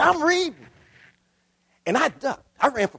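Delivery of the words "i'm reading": put-on high, loud, stubborn boy's voice; a child refusing to put down his comic; defiant boy. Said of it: "He said".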